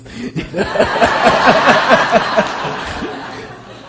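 long breathy burst of laughter; laughing hard at your own joke; big laugh. Real, not acted. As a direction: crack up at the punchline. Laughing at Indiana Jones punchline.

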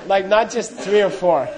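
usual speaking voice

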